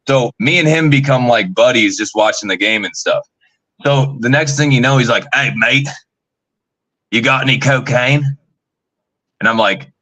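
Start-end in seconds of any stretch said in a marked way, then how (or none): 5.3-8.3 lightly imitated Australian; Australian accent. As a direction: ask it with a touch of Australian. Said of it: "he's got this Australian accent".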